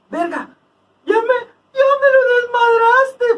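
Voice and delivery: high, whiny mock complaint; mock wail. Friend mocking someone whose umbrella broke, mock wailing. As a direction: wail it like a broken-hearted brawler.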